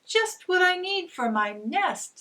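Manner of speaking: high, loud, excited squirrel voice; a chirpy critter thrilled by its find; delighted squirrel. A narrator's reading. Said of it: "said a squirrel".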